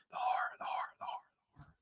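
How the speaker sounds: breathy, voiceless whisper of the words. A narrator's reading. Dusk repeating the dying words, a persistent whisper. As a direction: let it hiss like the wind. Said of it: "repeating them in a persistent whisper".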